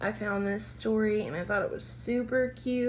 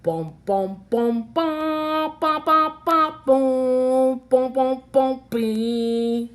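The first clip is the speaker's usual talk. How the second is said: tuba imitation; 3 semitones higher, 8 dB louder, slower than usual. Narrator mimics tuba playing.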